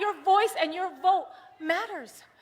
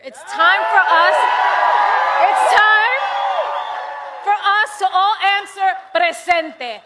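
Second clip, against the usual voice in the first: louder, rising rally cry; rally shout; 4 semitones higher, 8 dB louder than usual. Real, not acted. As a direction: call the crowd to answer, loud and rising.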